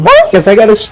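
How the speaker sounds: squeaky, shrieked name, then high; mock high-pitched creature voice. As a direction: imitate a little creature squealing. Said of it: "a high-pitched voice".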